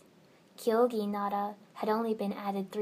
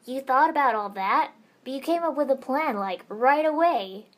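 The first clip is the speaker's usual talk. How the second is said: amazed, louder and higher; stunned; 5 semitones higher, 8 dB louder than usual. Acted. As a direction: blurt it out in disbelief. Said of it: "Naruto looked stunned".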